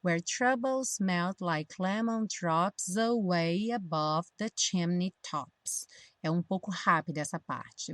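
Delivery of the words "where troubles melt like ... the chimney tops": slow full line, "drops away" run together; linked pronunciation practice. Shown as a demonstration. Teacher modelling lyrics for learners, slow line with "drops away" linked. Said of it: "o drops junta com away".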